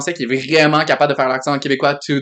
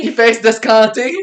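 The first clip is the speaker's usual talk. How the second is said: put-on Saguenay accent; 6 semitones higher, 4 dB louder than usual. Mimicking the regional expression.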